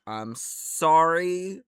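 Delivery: high, loud, stretched-out sorry; mock apology. Real, not acted. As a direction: drag out a cheeky apology.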